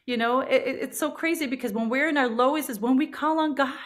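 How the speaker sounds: normal talking voice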